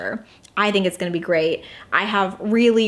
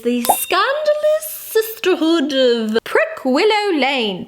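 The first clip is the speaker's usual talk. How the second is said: put-on slow, higher title read in a British accent; mock British accent on the book title; 7 semitones higher, slower than usual. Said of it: "I don't know why I did the accent".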